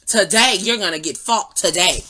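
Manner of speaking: loud, playful fighting talk; booming mock challenge, hitting each "today" hard; mock threat. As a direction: declare a hammed-up threat at full volume. Mock threat to the slipping device.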